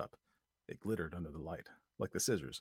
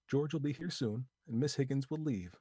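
put-on lightly higher, brisk young woman; Lucy planning the escape, lightly higher voice; Lucy's voice; 6 semitones higher than usual. A narrator's reading. Lucy telling Vi the plan, a lightly voiced young woman.

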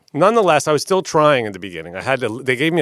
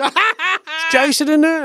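mock Australian rocker; 4 dB louder than usual. Rock star calling at an office door, high mock Australian. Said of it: "I hear him going".

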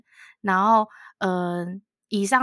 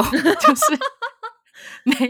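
laughing; 7 semitones higher, 5 dB louder, slower than usual. Real, not acted. Laughing at the absurd story.

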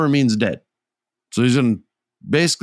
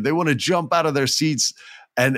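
ordinary talk, nothing marked

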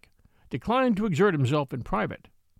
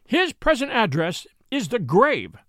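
higher, louder and slow, each word hammered; furious widow snapping at the doctor; emphatic fury; 5 semitones higher, 4 dB louder, slower than usual. Acted. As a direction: lose your temper and make the glasses ring. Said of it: "speaking with an emphasis and fury".

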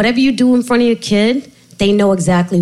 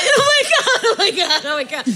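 laughing; 13 semitones higher than usual. Real, not acted. Cracking up to the host over the therapist's question.

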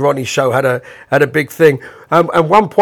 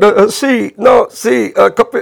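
flustered stammer; 7 semitones higher, 3 dB louder than usual. Retold or imitated. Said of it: "Bob sort of went".

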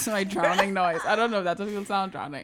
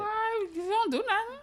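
pitched-up mock shock; high teasing disbelief; 13 semitones higher than usual. Real, not acted. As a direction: squeak out the disbelief.

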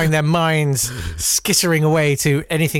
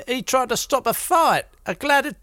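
higher, slow gossip-tale voice; mock storyteller; 9 semitones higher, slower than usual. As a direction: spin it like a hammed-up pub yarn. Mock tale told to friend.

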